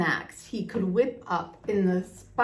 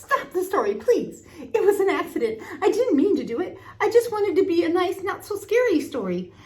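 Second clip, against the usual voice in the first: much higher and louder, fast, panicky; frightened pleading; 11 semitones higher, 5 dB louder than usual. A narrator's reading. Scientist begging the villagers, high-pitched and frightened. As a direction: beg them to stop, on the edge of tears.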